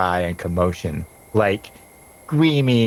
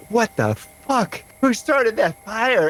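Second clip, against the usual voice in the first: woman yelling out; 13 semitones higher, slower than usual. Acted. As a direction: play a startled woman's outcry, pitched way up. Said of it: "the woman just yelled".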